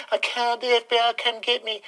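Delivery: high, put-on frightened whimper; mocking scared conservative. Ranter mocking timid conservatives with a high whimper. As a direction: squeak it out like a coward. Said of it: "the Conservatives are just sitting there going".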